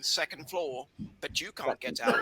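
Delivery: imitating a deep elevator voice; low, soft lift announcement, lightly put on; Caleb. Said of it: "hear Caleb Maupin's voice going".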